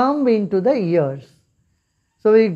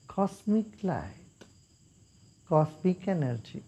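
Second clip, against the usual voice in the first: guided meditation; 3 semitones lower than usual. Acted. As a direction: guide them gently into calm. Leads a meditation.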